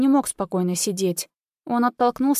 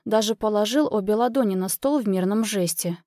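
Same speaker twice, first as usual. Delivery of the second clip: usual speaking voice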